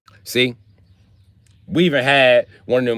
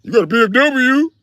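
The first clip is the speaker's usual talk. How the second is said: high, loud incredulous question; jealous disbelief, pitched way up; mock surprised; 13 semitones higher, 4 dB louder than usual. Homeless man at the shelter sees his BMW, high incredulous. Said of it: "make all the other homeless people mad".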